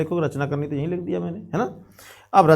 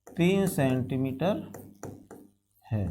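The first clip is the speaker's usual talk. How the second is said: slow, quiet word-by-word dictation; dictating while writing; 5 semitones lower, 9 dB quieter, slower than usual. Real, not acted. Teacher writing out the given data for students, slow dictation. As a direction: say it softly, piece by piece, with long pauses.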